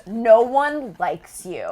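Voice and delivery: very slow, loud, high mock jeer; mock taunt. Actress repeating a troll's message for laughs, mock taunting. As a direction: stretch every word of the childish insult.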